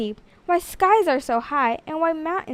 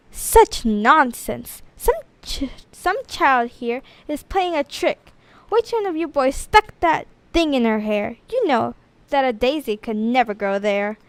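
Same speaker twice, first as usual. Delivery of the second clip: stern teacher. Acted. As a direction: scold the class like a fussy schoolteacher. Teacher scolds the class.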